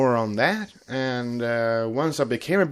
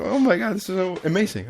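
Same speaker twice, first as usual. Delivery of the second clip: much higher, gushing; retold excitement; 7 semitones higher, much faster than usual. Retelling his reaction to finding brony songs, gushing. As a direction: squeal of delight. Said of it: "I was like".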